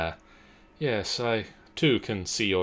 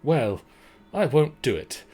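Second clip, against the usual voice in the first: louder and higher, indignant; indignant refusal; 4 semitones higher, 6 dB louder than usual. Acted. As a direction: huff it out, prim and offended. Character refuses firmly.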